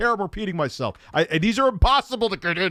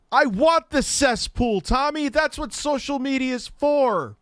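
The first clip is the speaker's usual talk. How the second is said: heated rant; 11 semitones higher, slower than usual. Real, not acted. Ranting about social media.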